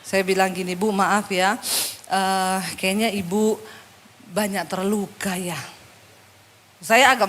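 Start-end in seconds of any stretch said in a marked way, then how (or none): 0.7-5.6 low, soft, hesitant apology; careful gentleness. Retold or imitated. Pastor to wounded woman, careful gentle hesitation. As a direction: ease gently into a delicate remark.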